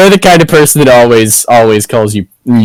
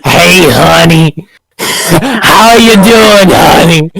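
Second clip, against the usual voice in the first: higher, slow, syrupy chain-smoker drawl; Patricia's voice; 4 semitones higher, slower than usual. Acted. Showing off her planned character Patricia, slow higher chain-smoker drawl. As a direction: lean in and slowly coo honey like an old barfly. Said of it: "And they talk like this".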